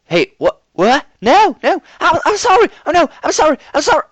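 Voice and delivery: loud, high frantic pleading; panicked yelling. A narrator's reading. Applejack yells apologies at something attacking her, panicked. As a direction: beg in terror. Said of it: "Applejack's yells".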